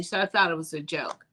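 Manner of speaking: ordinary talk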